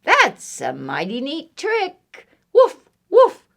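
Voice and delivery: high-pitched dog voice ending in loud woofs; impressed dog. Acted. Dog admires duck's bike trick, high doggy voice. Dog's thought voiced in story.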